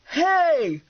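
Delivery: high, carrying call; indignant yell. A narrator's reading. Man to fugitive driving off, indignant yell. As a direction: yell after someone getting away. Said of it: "Clown yelled to Bender".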